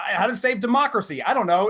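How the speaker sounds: ordinary talk, nothing marked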